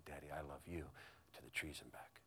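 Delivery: low, very soft imitation of a child; tender bedtime voice. Little daughter to her dad in bed, retold in a hush. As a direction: quote a little one's hushed affection.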